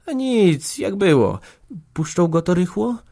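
very high, anxious voice; high-pitched worried questioning; trembling with dread. A narrator's reading. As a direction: ask in fear of the answer. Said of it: "zapytała z dygotem trwogi".

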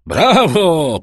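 loud, high cheer; cheering shout. A narrator's reading. Sailors cheer Edmund's steering, loud high cry. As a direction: cheer him like the whole crew. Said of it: "powtórzyli marynarze".

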